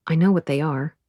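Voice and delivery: ordinary delivery, nothing marked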